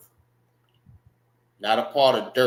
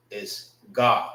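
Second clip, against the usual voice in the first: ordinary talk, nothing marked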